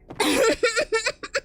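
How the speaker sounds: short high-pitched cackle; high laugh. Real, not acted. Joking with chat, a high giggle.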